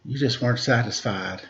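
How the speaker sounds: usual speaking voice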